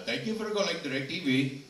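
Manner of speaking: imitating an Indian call center agent; Indian call center accent. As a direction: play the Indian tech-support rep. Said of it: "you're the India guy".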